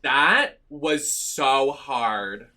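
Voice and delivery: loud, high cheer, then drawn out very slowly; relieved cheer. Real, not acted. Gamer beats the hard level, loud relieved cheer. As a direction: burst out with relief, then stretch it.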